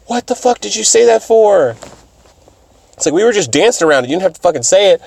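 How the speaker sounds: lightly acted exasperation; mock scolding. Acted scolding for tactless remark.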